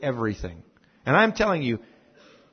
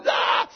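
short retold yelp of a scared little boy; four-year-old running home screaming; mock scream of fright. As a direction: let out a quick frightened "Ah!". Boy flees dog, screaming home.

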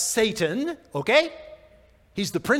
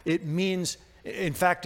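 ordinary delivery

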